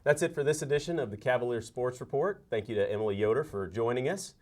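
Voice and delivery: polished, upbeat presenter close; announcer sign-off. Acted. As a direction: project it like a broadcast outro. Host reads the show sign-off.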